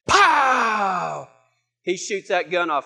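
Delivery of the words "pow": big drawn-out gunshot pow; gunshot imitation. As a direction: let the pow boom and trail away. Imitates the shotgun blast.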